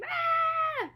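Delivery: high, loud held scream; playful demonstration scream. Shown as a demonstration. Teacher showing children screaming it out, high playful scream. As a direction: let out a high mock scream.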